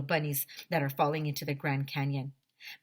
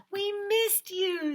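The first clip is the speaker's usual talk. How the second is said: much higher slow cooing; child's doting coo; 14 semitones higher, slower than usual. Acted. Andrea greeting the returned dog, high and cooing. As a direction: coo to the dog like a little girl.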